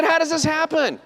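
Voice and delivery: high, laughing astonishment; amazed disbelief. Retold or imitated. Dentist marveling at the patient's hair source.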